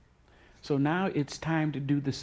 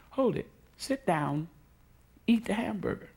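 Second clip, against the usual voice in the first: higher-pitched, lightly voiced boss giving a kind order; firm command; 7 semitones higher than usual. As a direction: stop her kindly but firmly, as the boss. Sullivan insists she eat.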